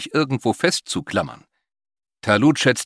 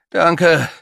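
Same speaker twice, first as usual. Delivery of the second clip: higher, louder character voice; rescued man's voice; 8 semitones higher, 8 dB louder than usual. A narrator's reading. Rescued man thanks Talut, high raised voice. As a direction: speak up, pitched well above the narration. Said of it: "sagte der Mann".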